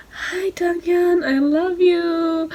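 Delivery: high, slow, breathless gush with a crack of tears; gushing to her idol, almost crying; starstruck fangirl. Retold or imitated. Fan greeting her idol at the signing, tearful gush.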